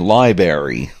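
"library" drawn out as lie-berry; mispronounced library. Retold or imitated. Host listing mispronounced words, "library" slowly as lie-berry.

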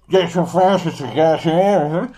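slow, mushy mimic of a teammate's mumble; garbled random teammate. Mocking a random teammate, slow and garbled. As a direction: drag out the muddled words like a bad mic. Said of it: "it'll always be like".

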